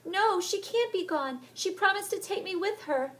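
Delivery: cling to the promise and call for her; desperate girl's cry. Acted. Heather crying out for Helen in the rain. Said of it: "she called out".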